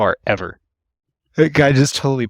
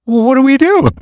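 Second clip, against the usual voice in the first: much higher, loud baffled plea; exasperated high squeak; 8 dB louder than usual. Retold or imitated. To the confusing patient, high loud bewildered question. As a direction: throw your hands up, voice cracking high.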